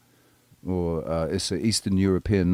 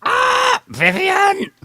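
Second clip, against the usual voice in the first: high falsetto squeal of the name; cartoonish high-pitched screech; mock shriek; 7 dB louder, much slower than usual. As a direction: shriek it in a shrill falsetto, hammed-up.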